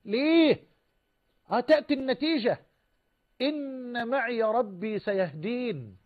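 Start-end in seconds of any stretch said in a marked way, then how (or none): 3.4-6.0 slow, drawn-out, softened recitation; scriptural quotation. Retold or imitated. Preacher quoting Moses' answer to his people, slow scriptural quotation. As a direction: stretch the long syllables with measured assurance.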